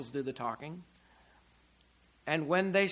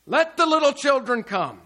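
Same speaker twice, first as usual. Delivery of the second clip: loud, raised declaration; ringing, higher-pitched preacher's quote; commanding Jesus; 7 semitones higher, 9 dB louder than usual. Retold or imitated. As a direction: proclaim it as a firm command. Jesus overruling the disciples.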